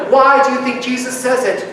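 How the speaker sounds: usual speaking voice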